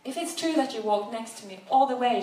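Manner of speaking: bristling, mildly defensive. Acted. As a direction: push back on the defensive. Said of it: "she said defensively".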